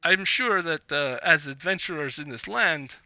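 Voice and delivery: lightly voiced Vistani trader, eager to make a deal; Vistani businessman. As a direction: play a businessman making his pitch, halting between phrases. Game master voices Vistani businessman.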